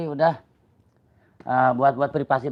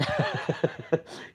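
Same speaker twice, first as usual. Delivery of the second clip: airy laugh; 4 semitones higher, 6 dB quieter than usual. Real, not acted. Laughs at stuck chat joke.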